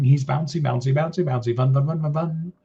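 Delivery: normal talking voice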